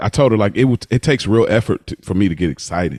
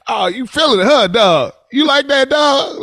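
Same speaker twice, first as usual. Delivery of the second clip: much higher, teasing hype-man voice; mock hype; 14 semitones higher than usual. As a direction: egg him on, high and teasing. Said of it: "you would be like".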